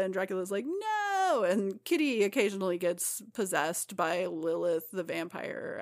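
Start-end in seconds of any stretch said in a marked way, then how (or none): 0.7-1.4 high drawn-out wounded no; mock dismayed Dracula. Dracula rejected by Rachel, high hammed-up wounded no. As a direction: whine it like a jilted villain.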